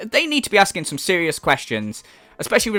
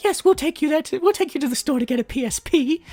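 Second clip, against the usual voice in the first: high-pitched, fawning parent voice; mock an anxious parent giving in at once; doting parent; 9 semitones higher than usual. Parents placating their spoiled son, mock fawning. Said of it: "and they're like".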